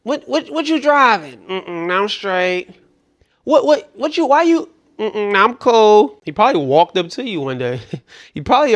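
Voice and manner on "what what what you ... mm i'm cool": high-pitched mock picky woman; dismissive woman rejecting suitor. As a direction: put on a high voice, brushing him off. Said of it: "when you said".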